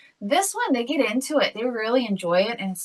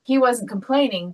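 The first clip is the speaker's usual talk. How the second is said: ordinary delivery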